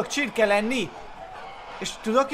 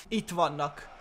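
ordinary talk, nothing marked

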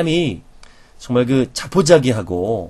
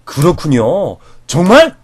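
lively nods with a rising, surprised ask; surprised interest; 4 semitones higher, 6 dB louder, slower than usual. Shown as a demonstration. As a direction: build from nodding to real surprise. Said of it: "이 변화를 줘야 돼요".

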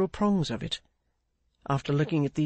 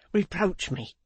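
pleading cry, slightly raised; mild cry; 3 semitones higher than usual. A narrator's reading. Pancks begging Clennam to blame him, mild cry. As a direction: cry out for blame, without a shout. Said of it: "cried Pancks".

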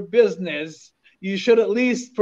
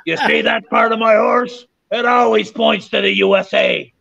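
louder hammed-up punchline in a put-on voice; punchline shout; 3 semitones higher, 3 dB louder than usual. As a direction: belt out the joke's payoff in character. Mountie delivers the punchline.

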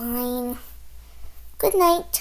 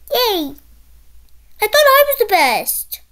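normal talking voice

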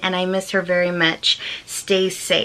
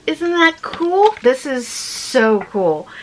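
thrilled; 5 semitones higher, slower than usual. Real, not acted. Admiring a handmade card.

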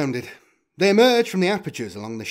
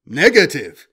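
loud, sudden machine shout; robot's loud refusal; 10 dB louder than usual. A narrator's reading. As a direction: blurt it like a robot springing back to life.

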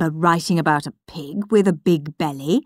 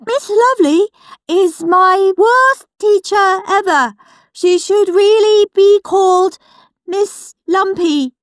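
high and loud, unaware of the insult; loud proud reading; 11 semitones higher, 6 dB louder than usual. Acted. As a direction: blare it out innocently. Peter reading loudly.

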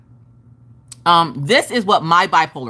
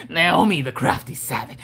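raspy, lower name call; weird raspy voice; 4 semitones lower, 3 dB quieter than usual. Shown as a demonstration. Streamer announcing a savage viewer's name, in weird rasp. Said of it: "weird raspy voice".

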